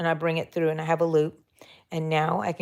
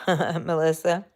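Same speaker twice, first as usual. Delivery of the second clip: ordinary talk, nothing marked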